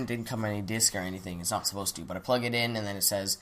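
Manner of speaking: ordinary talk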